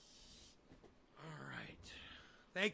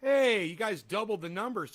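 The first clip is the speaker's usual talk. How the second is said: excited greeting; 6 semitones higher, 11 dB louder than usual. Real, not acted. Greeting returning stream audience.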